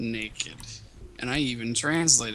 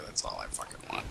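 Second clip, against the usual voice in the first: muttered whisper; 11 dB quieter, faster than usual. Real, not acted. Muttered aside after crude joke.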